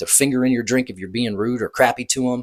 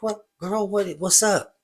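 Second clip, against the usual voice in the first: curious friend; 8 semitones higher than usual. Retold or imitated. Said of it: "I was like".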